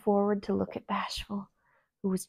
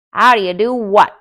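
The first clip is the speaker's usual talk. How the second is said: loud, gruff retort; Grumpy rude to the princess; frowning retort; 15 dB louder than usual. A narrator's reading. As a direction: snap it back rudely. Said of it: "with a frown".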